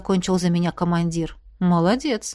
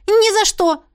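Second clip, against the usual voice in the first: high, loud outburst; emphatic refusal; 12 semitones higher, 5 dB louder than usual. A narrator's reading. Heroine rejects being the dragon's bride, emphatic refusal. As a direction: refuse point-blank, pitch shooting up.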